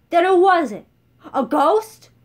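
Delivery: furious sneering shout. Acted. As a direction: sneer the question at full volume. Chancellor yells back.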